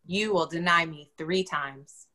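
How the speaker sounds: ordinary delivery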